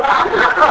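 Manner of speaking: a quick breathy burst of laughter; amused little laugh; short laugh. Real, not acted. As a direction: laugh at yourself. Laughing at his own gym story.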